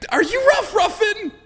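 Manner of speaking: high, loud, slow disbelief; incredulous. Retold or imitated. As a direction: ask it stunned. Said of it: "I was like".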